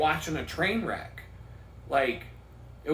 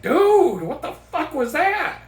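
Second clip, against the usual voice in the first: much higher, loud incredulous outburst; stunned disbelief; 11 semitones higher, 8 dB louder than usual. Retold or imitated. Viewer shocked after a bad HBO stand-up set. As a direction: blurt it out, stunned by the show. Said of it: "you're just like".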